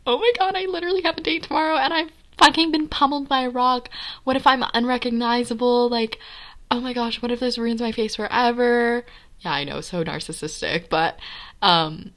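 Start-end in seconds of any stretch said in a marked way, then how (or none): 0.1-9.0 frantic panic. Retold or imitated. Injured girl panics about her date tomorrow: high, fast, frantic. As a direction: panic rushing out at a high pitch.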